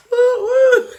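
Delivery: high, loud joyful whoops into laughter; whooping. Real, not acted. Celebrating a sale with whoops and laughter. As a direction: shout for joy then laugh.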